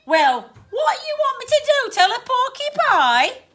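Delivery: high, loud, exasperated lad; indignant Cockney retort. Acted. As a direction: argue back in rough Cockney. Annoyed Cockney ghost argues back.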